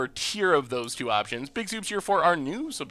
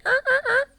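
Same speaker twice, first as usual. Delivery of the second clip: high warm-up noises. Pretend. Warming up into stream mode.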